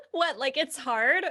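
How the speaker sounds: light, breezy imitation of Elle; mock innocent surprise. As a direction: toss it off as if it were nothing, with a laugh in the voice. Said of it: "quoting Elle's Harvard scene".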